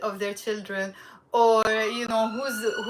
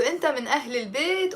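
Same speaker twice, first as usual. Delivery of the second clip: "أنت من أهل البيت" rattled off fast; quick natural speed; much faster than usual. Shown as a demonstration. Teacher giving the everyday fast version, quick. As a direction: say it at native speed.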